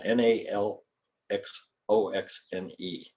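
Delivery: naloxone spelled letter by letter, "N A L X O X N E". Shown as a demonstration. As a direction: spell "N A L X O X N E" one letter at a time, slowing down. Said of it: "You ever heard of that?".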